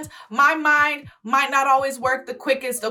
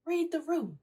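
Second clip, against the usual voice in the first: much softer, higher aside; hushed rebuke; 5 semitones higher, 12 dB quieter than usual. Real, not acted. Scolding the "that guy" commenter, hushed and pointed. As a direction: repeat it very quietly, pointedly. Exasperated rebuke, repeated under breath.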